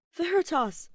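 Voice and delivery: loud, high pleading call of a name; pleading cry. Acted. Aventurine begs Ratio to notice him, a loud pleading cry. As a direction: plead with him to look at you. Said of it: "he pleads".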